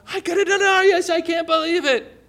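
high, laughing disbelief; a hammed-up, giddy worker voice; amazed workers. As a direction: gush like someone who just got rich. Workers marvel at their pay.

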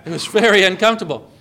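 laughing through the words; amused, real laugh while telling. Real, not acted. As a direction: chuckle at the awkward memory. Laughing at awkward silence.

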